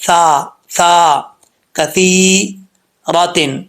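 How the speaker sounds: slow سا with no hissing whistle; sa without whistle. Shown as a demonstration. Teacher to students, slow سا without whistle. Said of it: "بغیر سیٹی کے".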